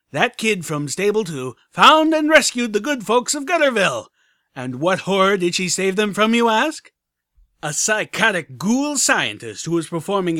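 loud, high radio DJ hype; DJ Pon3. Acted. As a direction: hype up the listeners like a radio host. Radio host voice.

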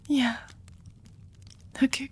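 ordinary talk

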